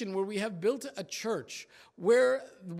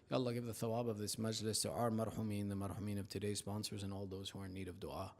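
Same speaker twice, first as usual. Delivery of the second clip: soft, fast prayer voice; quiet supplication; 10 semitones lower, 10 dB quieter, faster than usual. Acted. Imam closing the majlis with dua, soft fast prayer. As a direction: murmur the dua humbly.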